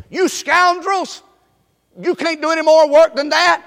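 a boss berating his crew; mock harsh boss. Boss scolding his workers, in a mock harsh voice. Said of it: "He didn't say".

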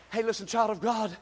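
ordinary delivery

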